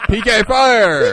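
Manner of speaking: slow, loud, laughing battle cry; game attack shout. Pretend. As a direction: bellow the move name while laughing.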